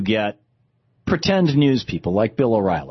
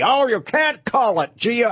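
high-pitched fake Australian; badly faked Aussie objection; terrible Australian accent; 9 semitones higher than usual. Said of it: "I do a terrible Australian accent".

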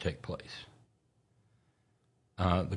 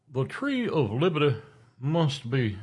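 raised pompous quote; 6 semitones higher than usual. Shown as a demonstration. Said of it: "sit, liberty, sit".

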